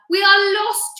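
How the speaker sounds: high panicked wail; frightened cry. A narrator's reading. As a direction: cry out in despair. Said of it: "cried Ladybird".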